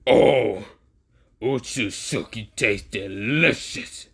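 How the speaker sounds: slow, lightly voiced monster purr. Venom gloating over his meal, slow monster voice. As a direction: savor each word like a hungry beast.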